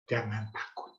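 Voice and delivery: soft, tender reassurance; gentle. Shown as a demonstration. Jesus comforting a frightened believer, softly. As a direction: say it gently like a caring father. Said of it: "Dia nggak bilang dengan bentak".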